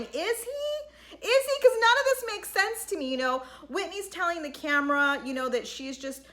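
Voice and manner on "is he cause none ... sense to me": high sceptical is he; incredulous. Real, not acted. Speaker doubting the Frenchman's feelings, high incredulous. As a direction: sound openly disbelieving.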